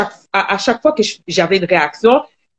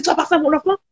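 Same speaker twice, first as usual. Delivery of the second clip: higher-pitched mock accusation; imitating the boyfriend's accusing tone; retold angry partner; 10 semitones higher, much faster than usual. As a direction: repeat his accusation, pitched up. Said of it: "T'as dit".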